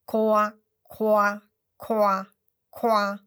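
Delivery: loud, bright frog croak "koa"; croaking frog call. Acted. Frog announcing itself in the forest, loud croak.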